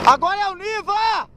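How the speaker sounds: hype friend cheering. Real, not acted. Friends cheering a car through mud.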